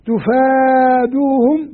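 تفادوهم stretched slowly, rising at the end; slow recitation. Shown as a demonstration. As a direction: sound out تفادوهم slowly with a lift. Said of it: "القراءة الأولى".

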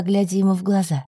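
ordinary delivery, nothing marked

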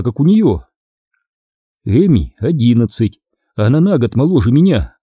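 ordinary delivery

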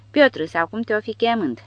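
loud, hearty call to the Russian; a roaring, jovial hail; roared greeting. A narrator's reading. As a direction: bellow it with a backslap. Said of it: "răcni Barcelona".

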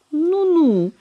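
high teasing "Ну-ну" like wagging a finger; playful tut-tut. A narrator's reading. Companion teasing the old lady with a wagging finger, high coy tut-tut. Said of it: "мисс Найт шаловливо затрясла пальчиком".

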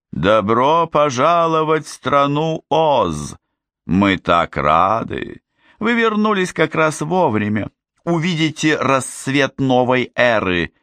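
high, syrupy good witch; sweet fairy voice. Acted. Glinda welcomes the witches back to Oz, high and syrupy. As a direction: beam a falsely cheerful welcome, high.

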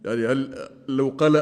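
ordinary talk, nothing marked

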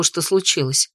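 plain reading voice

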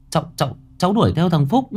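flustered stammer. Acted.

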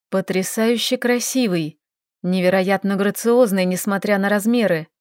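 plain reading voice

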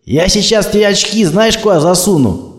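high, loud threatening bark; barking threat. Acted. Thug threatens the woman driver, barking loudly. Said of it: "гаркнул он".

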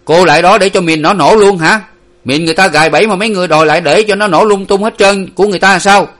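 loud, high, rapid scolding; exasperated shouting sentry; out of patience. A narrator's reading. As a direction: berate her angrily for risking the mines. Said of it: "hết còn kiên nhẫn gì nữa".

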